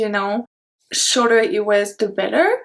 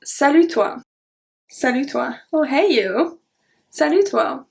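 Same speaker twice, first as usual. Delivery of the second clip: playful, teasing salut toi; flirty; 2 semitones higher than usual. Shown as a demonstration. Teacher shows flirting with a friend, teasing. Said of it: "the flirty one".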